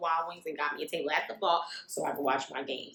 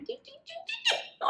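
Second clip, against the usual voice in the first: tapping sound effect; 5 dB quieter than usual. Retold or imitated. Tapping man's back.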